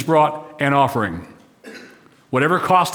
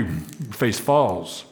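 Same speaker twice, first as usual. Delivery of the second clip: falling face demo; 6 semitones lower, 6 dB quieter, much slower than usual. Shown as a demonstration. Preacher showing an angry fallen face, low, soft and slow. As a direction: drop the voice low and soft, slowly, on falls. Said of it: "when you're angry".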